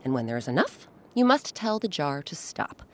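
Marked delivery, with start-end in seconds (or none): none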